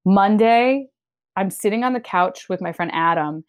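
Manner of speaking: ordinary delivery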